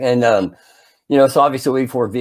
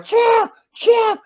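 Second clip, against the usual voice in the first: very high-pitched repeated call; mock yelling. Boy warning friend that his mask is up, high-pitched mock yell. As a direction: call his name urgently in a strained high voice. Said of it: "I'm yelling Jeff the whole time".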